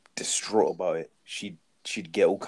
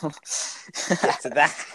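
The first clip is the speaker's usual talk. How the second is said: drawn-out mock echo; 6 semitones higher, 4 dB louder, much slower than usual. Echoing his friend's line in a slow mock voice. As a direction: stretch each word slowly in a silly voice.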